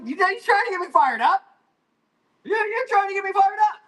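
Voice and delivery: high, loud mock outrage; mock fired up. Mock outrage at a chat question about water. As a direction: play at being riled up by chat.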